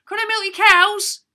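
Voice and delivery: high, loud, eager request; cheery request. Acted. Drench cheerily asking neighbours at three in the morning, high and loud. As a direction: chirp it out full of hope.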